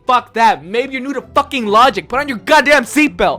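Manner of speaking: building from irritated to high, loud shouting; angry rant. Acted. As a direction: escalate into a furious tirade at the driver.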